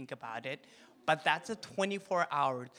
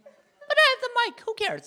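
much higher, louder teasing; playful gloat; 9 dB louder than usual. Real, not acted. Pastor teases his wife from the stage, higher and louder. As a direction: crow about holding the mic.

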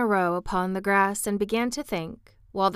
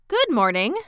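a polite, slightly louder good morning; polite greeting; 4 semitones higher, 6 dB louder than usual. A narrator's reading. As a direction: greet the stranger courteously. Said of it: "he said politely".

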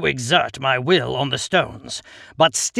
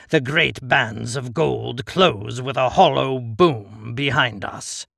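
plain reading voice

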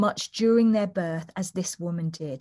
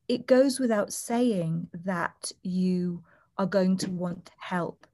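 normal talking voice

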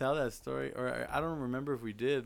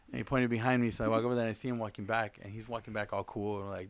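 normal talking voice

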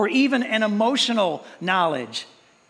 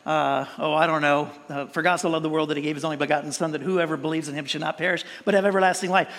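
rushed mumbled recitation; 4 semitones lower, faster than usual. Retold or imitated. Preacher mocking kids who race through memory verses, fast mumble. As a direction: gabble the verse low and fast, like a bored kid. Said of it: "you gotta do it as fast as possible".

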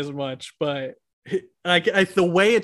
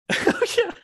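ordinary delivery

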